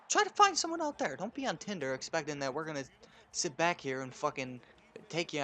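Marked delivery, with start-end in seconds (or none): 0.1-1.2 much higher and louder, yelling the advice; ranting shout. Real, not acted. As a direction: shout it at the listeners.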